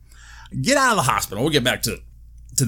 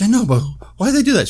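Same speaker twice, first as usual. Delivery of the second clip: agitated; 11 semitones higher, 3 dB louder, much faster than usual. Retold or imitated. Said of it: "I was like".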